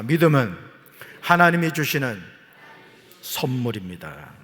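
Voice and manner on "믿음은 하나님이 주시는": louder call-out phrase; call for repetition. Shown as a demonstration. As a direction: lead the congregation line by line. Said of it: "따라합시다".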